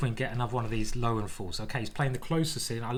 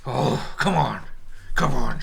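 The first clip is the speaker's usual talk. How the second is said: urgent loud urging; 7 dB louder, slower than usual. Real, not acted. Urging during tense moment.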